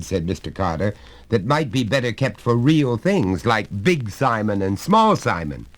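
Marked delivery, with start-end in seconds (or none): 1.3-5.7 higher, louder jovial father voice; a hearty father coaxing his son; genial father. Acted. Father corrects the boy.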